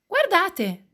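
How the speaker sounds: put-on high child voice, drawing attention; bambino che avvisa. A narrator's reading. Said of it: "dice Filippo". (voce acuta di bambino)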